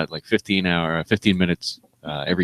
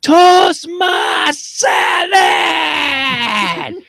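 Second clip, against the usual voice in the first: high, loud, stretched-out holler; imitated inmate scream; 6 dB louder, much slower than usual. Inmate screaming down at a new prison worker, imitated long scream. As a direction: scream it down a hollow hallway. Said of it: "looking down at me and screaming".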